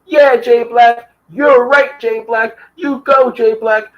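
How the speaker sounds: high, slow sing-song agreement; mocking fawning fans. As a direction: drawl the praise up high like a sycophant. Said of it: "Those same people who were".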